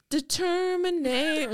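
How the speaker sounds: high, slow, stretched word; hammy singer voice. Retold or imitated. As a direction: croon it dramatically. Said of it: "And then he's like".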